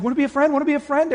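high, loud, overeager puppy voice. Retold or imitated. Extrovert mimicking a puppy at a shy newcomer, high and eager. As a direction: bounce at them like a pup begging for a friend.

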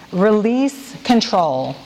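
slow, deliberate, each word laid out; slow recited phrase. Shown as a demonstration. Leader reading an acrostic to the women, slow and deliberate. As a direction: recite it slowly for the group to echo. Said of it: "Say that with me".

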